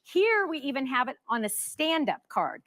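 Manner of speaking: ordinary talk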